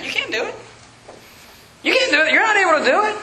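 high-pitched mimicry of doubters; mocking naysayers. Preacher imitating people who doubted his church, high-pitched mockery.

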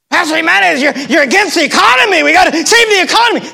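high, loud, hurried complaint; mock alarmed critic. Preacher mimics a member upset about the economy, mock frantic protest.

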